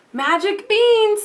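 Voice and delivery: lightly voiced old beggar, slow and high; creaky beggar voice. A narrator's reading. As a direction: offer the beans like a sly old beggar. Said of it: "the beggar said in a creaky voice".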